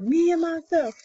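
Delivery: high, proud roared boast; chest-out lion roar. A narrator's reading. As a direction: roar it out like a proud king of beasts. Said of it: "he's roaring proud".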